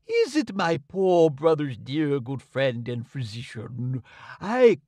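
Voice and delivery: lightly voiced king, slow and gushing; a hint of an eager flatterer; fawning king. A narrator's reading. King greeting the doctor, mildly fawning.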